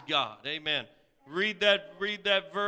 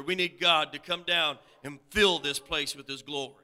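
ordinary talk, nothing marked

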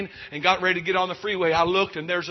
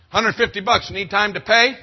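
judge handing down fines; 4 semitones higher, 10 dB louder than usual. Retold or imitated.

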